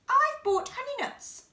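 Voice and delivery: high, cheerful badger voice; grinning badger. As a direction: offer the treat with a grin. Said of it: "badger says with a grin".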